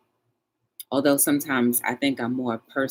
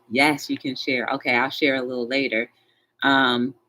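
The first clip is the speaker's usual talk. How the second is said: ordinary talk, nothing marked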